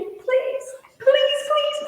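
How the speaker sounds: high pleading please, please, please; mock begging. Stranger begging her to let him touch her feet, high mock pleading. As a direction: beg like a creepy stranger. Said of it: "He started begging me".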